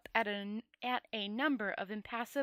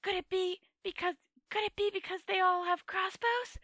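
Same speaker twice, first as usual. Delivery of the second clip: high voice; 10 semitones higher than usual. A narrator's reading. Rincewind's sarcastic reply.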